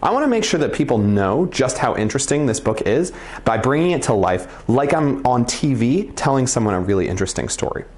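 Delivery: normal talking voice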